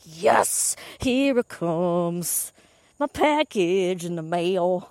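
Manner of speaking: slow, drawn-out savoring cheer; excited. Retold or imitated. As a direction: stretch each word in mock-triumphant anticipation. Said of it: "I was so excited".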